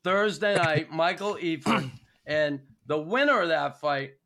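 ordinary talk, nothing marked

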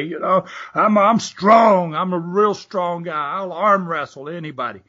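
bang the table and boast; mock angry bragging. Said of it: "slam his fist on the tables".

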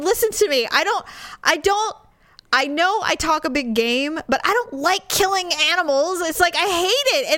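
high, pleading protest, acted out; upset. Wife defending herself to husband, upset. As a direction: plead your case, worked up. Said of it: "I was upset".

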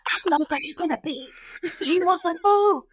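hushed, intrusive whispering; mock whisper. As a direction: imitate mom whispering questions during a recording. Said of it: "Like the whisper helps".

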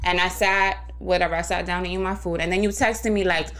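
usual speaking voice

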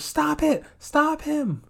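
high hammed-up yell; imitating her frantic plea; mock panicked scream. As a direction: shriek it like the chased girl. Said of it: "she goes".

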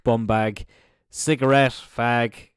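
usual speaking voice

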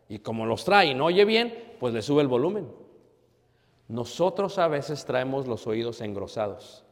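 ordinary talk

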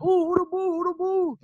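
high, hooting call without extra volume; imitated impressed cooing. Coach's wife seeing his move, high admiring call. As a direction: mimic an admiring woman, pitched well up.

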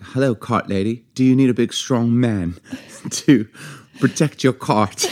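attempted strong man in a lilting British accent; feminine British accent. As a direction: put on a hammy, feminine British accent, breaking into a laugh. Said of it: "you have a very feminine British accent".

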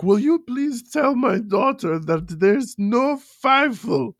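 a lightly voiced dad begging someone to end it; slow, put-upon mock father; grumpy dad. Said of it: "He's like".

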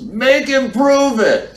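high, slow shouted demand; loud challenge. Retold or imitated. Diner in the back demanding proof, shouting slowly. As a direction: call out a dare across the room.

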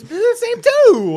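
high slow exclaim. Acted.

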